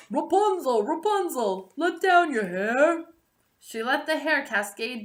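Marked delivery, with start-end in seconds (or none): 0.1-3.1 calling up to the tower. A narrator's reading. Prince calling up to Rapunzel in the tower. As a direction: call up to a high window. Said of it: "when the prince called up".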